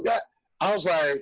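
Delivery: usual speaking voice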